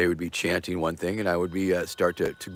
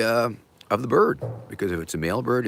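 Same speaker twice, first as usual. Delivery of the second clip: normal talking voice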